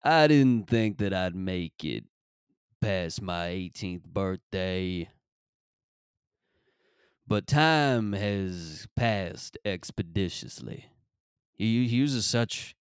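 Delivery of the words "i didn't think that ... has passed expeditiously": slow, drawn-out rapper delivery, lightly voiced; T.I. impression. Imitating a rapper reflecting on surviving, slow and lightly voiced. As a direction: draw every word out like a solemn rapper. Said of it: "guys like T I who are going".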